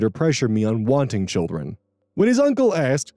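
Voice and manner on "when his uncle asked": usual speaking voice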